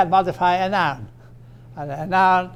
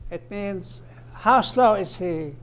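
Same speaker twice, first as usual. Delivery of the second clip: ordinary talk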